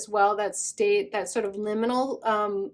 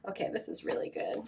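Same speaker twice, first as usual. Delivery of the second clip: whisper; 10 semitones lower, 12 dB quieter than usual. Real, not acted. Quiet aside over the cards.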